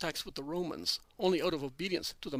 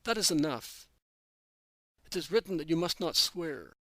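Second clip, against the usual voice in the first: severe; 3 dB louder than usual. A narrator's reading. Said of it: "orders Jesus with such severity".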